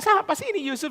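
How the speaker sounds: ordinary talk, nothing marked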